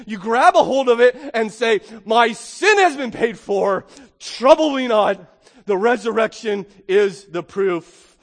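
loud, ringing pulpit proclamation; preaching at full force. Acted. Preacher rallying the Easter congregation, loud full-force preaching. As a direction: drive it home to the whole church.

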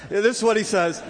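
trying to get back on track while laughing; still laughing. Real, not acted. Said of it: "no more corny jokes".

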